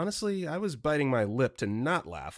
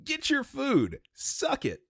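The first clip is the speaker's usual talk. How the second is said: high-pitched mocking jeer; gloating taunt; 13 semitones higher, slower than usual. Real, not acted. Host taunting the rude wings customer, gloating. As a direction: crow it in a much higher voice.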